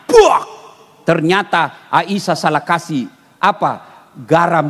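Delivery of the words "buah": loud high spitting sound; mock spit-out. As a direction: spit the drink out with a loud burst. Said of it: "langsung dimuntahkan".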